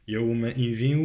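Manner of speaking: "يومئذ" ending in a held nasal w, no n; idgham of tanwin. Shown as a demonstration. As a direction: recite "يومئذ" humming into w. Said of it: "لا أقول يومئذ".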